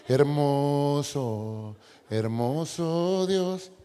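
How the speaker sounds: muy lento, alargado, falsa paz; mock serene. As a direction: estira cada sílaba con calma fingida. Finge paz, cantando lento. (fingiendo paz ante su esposa, muy lento)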